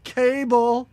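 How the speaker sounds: drawn-out, half-hearted calling out; mock shouting. Kid wandering the swamp all night, mock searching shouts. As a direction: call the names out as if searching, without full force. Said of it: "just shouting".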